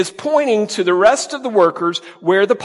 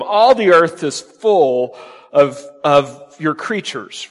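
slow emphatic reading; much slower than usual. Shown as a demonstration. Preacher slowly reading the verse to his congregation, slow and weighty. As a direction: read "All the earth is full of, of your creatures" very slowly, weighing each word. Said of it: "notice it says".

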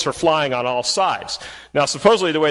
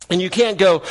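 ordinary talk, nothing marked

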